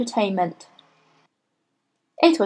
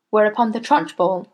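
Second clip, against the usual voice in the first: plain reading voice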